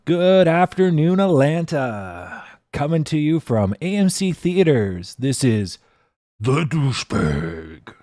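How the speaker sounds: booming hammed-up radio intro; announcer voice. Shown as a demonstration. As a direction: open the show like a big-time announcer. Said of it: "see, I got this. I got the mic for it now".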